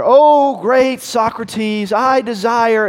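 very high, slow, loud pompous cry; pompous young man. Acted. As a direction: stretch out great like a grand fool. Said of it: "this kind of pompous numbskull".

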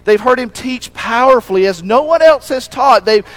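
a pastor building the congregation toward a climax; rising preaching. Acted. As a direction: preach it with mounting fervour. Preaching builds to full force.

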